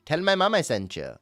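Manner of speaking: put-on high, loud accented shop pitchman; Ahmed. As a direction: plead for customers in the put-on accent. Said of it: "almost all of the voices that I do".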